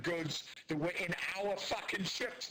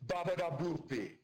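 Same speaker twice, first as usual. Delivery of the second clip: mock Italian gibberish. Shown as a demonstration. Mocking how a foreigner fakes Italian, cartoon Italian gibberish. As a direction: fake Italian nonsense sounds. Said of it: "if I were to speak Italian".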